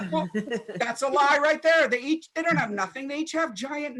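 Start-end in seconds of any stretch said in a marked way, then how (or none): none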